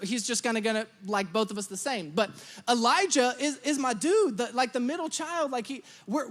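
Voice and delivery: ordinary delivery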